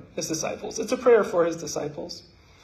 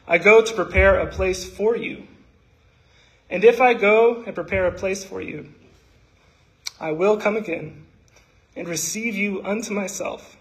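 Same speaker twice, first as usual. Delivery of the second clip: raised, earnest recital of Jesus' prayer; scripture recitation; 3 semitones higher, 4 dB louder than usual. Acted.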